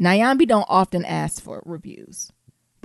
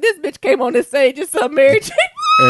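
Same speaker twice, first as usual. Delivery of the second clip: shocked exclaim; 7 semitones higher, 8 dB louder than usual. Retold or imitated. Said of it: "director went home and was like".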